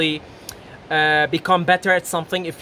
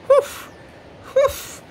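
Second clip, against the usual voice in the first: mock frying whoosh. Imitating frying food sizzling.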